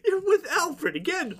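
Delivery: high-pitched comic disbelief; incredulous squeal. Acted. Pointing out he's with Alfred, high incredulous squeal. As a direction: squeal it, close to cracking up. Laughing at the absurd retold scene.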